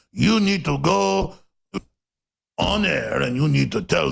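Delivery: hammed-up Russian accent, word by word; bad Russian accent. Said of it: "I don't really do a good Russian accent".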